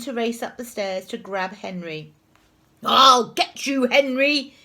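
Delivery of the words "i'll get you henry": loud, raised, angry shout; screamed threat. A narrator's reading. Teacher racing to grab Henry, angry scream. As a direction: yell it as a threat. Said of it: "he screamed".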